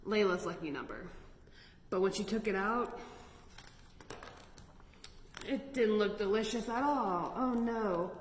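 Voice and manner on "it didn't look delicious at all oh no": usual speaking voice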